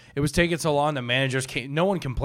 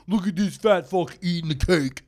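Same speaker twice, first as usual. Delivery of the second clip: mocking friend; 4 semitones higher than usual. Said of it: "He goes".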